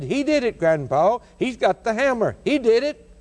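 put-on high, innocent child's voice blaming the idol; sly boy. Boy tells grandpa the idol did it, high and innocent. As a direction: play the boy, all wide-eyed innocence.

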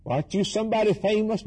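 quick, high-pitched imitation of a questioner; mock questioner. Onlookers questioning John the Baptist, mock questioner. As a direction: lightly act out a curious onlooker.

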